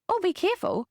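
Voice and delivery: high, falling caution; acted parent's high-pitched heads-up; parent cautioning child. Voiced parent cautioning climbing child.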